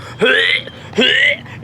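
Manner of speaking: mock retching, twice over; imitated dry heaving. Both wrestlers under the ring, mock dry heaves. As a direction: imitate someone about to vomit. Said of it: "they're both dry heaving down there going".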